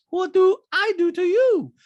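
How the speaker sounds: loud, very high mock impression; Diana. Diana challenging Sutton, loud high impression. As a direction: mimic her protesting up high. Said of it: "And Dinah goes".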